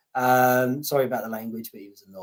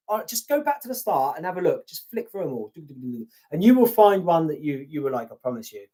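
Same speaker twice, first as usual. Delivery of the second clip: usual speaking voice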